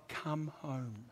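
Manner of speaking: soft tender come home; tender invitation. Acted. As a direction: invite them home softly.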